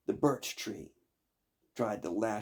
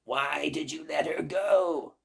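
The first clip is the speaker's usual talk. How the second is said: shrill shout at the maid; witch screaming; 7 semitones higher, 5 dB louder than usual. Acted. Baba Yaga screaming at her maid. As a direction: bellow at her servant.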